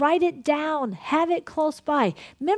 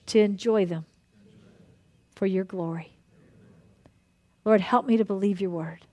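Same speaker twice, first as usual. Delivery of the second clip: earnest prayer; 2 semitones lower than usual. Acted. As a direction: pray it out for the whole room. Leads a group prayer.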